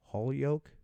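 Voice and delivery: Holyoke as HOLLY-oke, questioning; trying out pronunciation. Shown as a demonstration. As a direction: say Holyoke with an "ah" and a silent final e. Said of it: "I know I'm saying it wrong".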